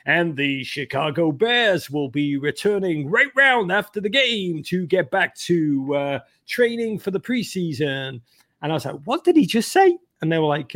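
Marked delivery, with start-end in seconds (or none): 0.0-8.2 slow, measured imitation announcer; broadcaster voice. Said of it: "the broadcaster at the end goes". 9.0-10.0 high-pitched incredulous question; mock astonishment. Retold startled reaction to broadcast.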